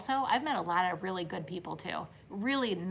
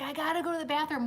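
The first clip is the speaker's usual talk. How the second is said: fast, higher-pitched child imitation; mock screaming kid; 6 semitones higher, much faster than usual. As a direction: lightly mimic a kid's urgent plea. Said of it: "the kid kept screaming and screaming".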